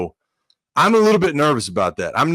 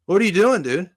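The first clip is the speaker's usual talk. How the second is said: high mock accusing; 7 semitones higher than usual. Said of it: "He goes".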